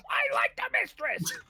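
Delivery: very high, loud yell; defiant shout. Acted. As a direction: hurl it like a tantrum.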